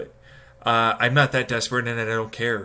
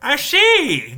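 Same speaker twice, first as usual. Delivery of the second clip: mock realization; 13 semitones higher, 7 dB louder than usual. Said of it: "And it's like".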